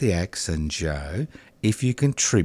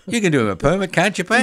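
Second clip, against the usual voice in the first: high, loud teasing question; 9 semitones higher, 4 dB louder, faster than usual. Real, not acted. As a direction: tease her playfully. Teasing co-host.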